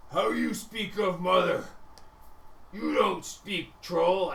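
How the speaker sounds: offended ogre. Acted. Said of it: "you hear back now in ogre".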